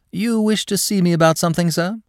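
high-pitched, eager to please; ingratiating. A narrator's reading. Clerk asks to see the manager, ingratiating. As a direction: ask it with a high, oily politeness. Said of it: "inquired Smith ingratiatingly".

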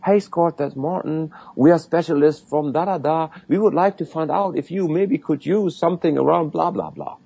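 sing-song boring sales spiel; mocking salesman pitch. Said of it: "Usually people say".

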